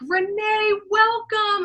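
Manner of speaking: high, sing-song warm greeting; effusive welcome. Retold or imitated. Film team to new actress arriving on set, effusively welcoming. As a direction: greet a newcomer like long-awaited family.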